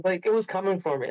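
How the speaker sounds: usual speaking voice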